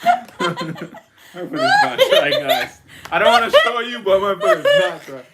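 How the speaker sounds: squealing, high laughing words; laughing so hard the voice jumps up. Real, not acted. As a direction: talk through a fit of laughter. Laughing protest about her feet.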